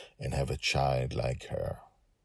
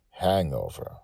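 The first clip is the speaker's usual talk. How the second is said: ordinary delivery, nothing marked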